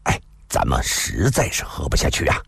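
low-pitched, ingratiating reply; humbly placating, pitched low; apologetic smile. A narrator's reading. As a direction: explain with a placating smile and a little sigh. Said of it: "一个人陪笑地说道".

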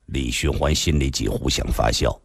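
ordinary delivery

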